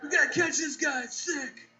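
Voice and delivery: high-pitched, retching movie cop; hammed-up disgusted police voice; queasy cop. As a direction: act a cop sickened at the scene. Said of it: "there's a cop puking in the background".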